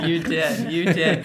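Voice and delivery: laughing through a warm agreement. Real, not acted. Host laughing as she confirms the guest's advice, laughing.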